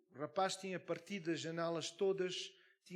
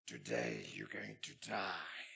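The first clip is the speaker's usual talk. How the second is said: very deep, slow menacing growl; demonic voice; 13 semitones lower, much slower than usual. Retold or imitated. Possessed man threatens the pastor in a deep demonic voice. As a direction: drag each word out low like a demon. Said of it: "Ele estava com uma voz, mas assim".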